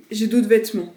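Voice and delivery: loud defensive insistence. Real, not acted. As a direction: insist loudly. Insisting loudly on own clothes.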